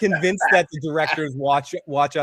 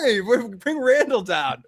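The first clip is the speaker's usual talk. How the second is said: higher, drawn-out mock call; mock summoning call; 7 semitones higher, slower than usual. Crew calling Randall down to break the door, playful summons. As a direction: lightly imitate someone calling for the door breaker. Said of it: "They bring in Randall".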